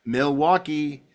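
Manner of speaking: normal talking voice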